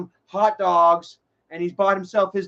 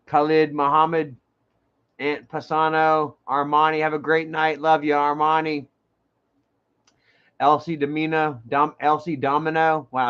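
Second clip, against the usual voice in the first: normal talking voice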